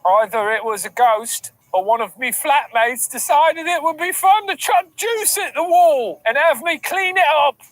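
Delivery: put-on story narration. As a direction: read it aloud as a drawn-out tale.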